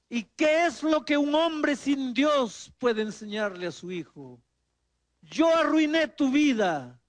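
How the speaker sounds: lenta y fuerte, llena de culpa; confesión dolida. Retold or imitated. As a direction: pide perdón con el alma. Padre confiesa su culpa. (padre arrepentido, lento y dolido)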